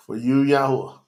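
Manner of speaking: emotional, halting, with a heavy sigh; overcome with emotion. Real, not acted. Preacher dedicating himself to Yahuwah, overcome with emotion. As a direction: break off, moved, then push the name out. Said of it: "I'm so emotional for Yahuwah".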